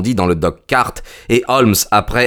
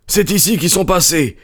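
eager stranger; 10 semitones higher than usual. A narrator's reading. Stranger points out tracks.

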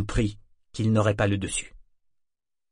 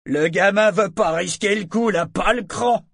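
high, loud taunt; jeering heckle; 10 semitones higher, 6 dB louder than usual. Acted. Onlooker mocks the boy's lack of nerve, jeering. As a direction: heckle from the crowd in a raised voice.

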